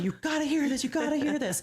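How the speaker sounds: high, eager call to the husband; calling out, thrilled, to share the find; shouting to her husband about the recording, pitched high; excited shout. Retold or imitated. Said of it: "I'm screaming for my husband".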